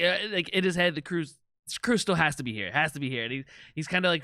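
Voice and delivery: usual speaking voice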